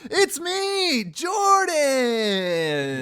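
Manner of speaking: very high put-on voice, loud; hyped self-introduction. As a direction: announce yourself in a high, stretched-out cry. High-pitched self-introduction after hype.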